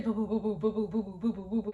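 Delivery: rapid, pulsing "boo boo" heartbeat imitation; imitated heartbeat monitor. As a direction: mimic a racing heart monitor in a rapid run. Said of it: "So all you hear is".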